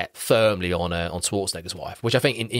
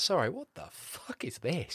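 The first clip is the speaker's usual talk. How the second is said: baffled Arnie; 8 semitones higher, 10 dB quieter, slower than usual. Retold or imitated.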